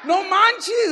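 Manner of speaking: high, loud mock outburst; hammed-up cry of disbelief; exasperated. Husband fed up with wife, exasperated high shout.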